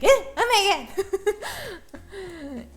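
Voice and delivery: high, loud startled gasp; mock panic. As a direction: act out a jolt of panic. Acting out the power of PTSD.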